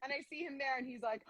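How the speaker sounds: ordinary talk, nothing marked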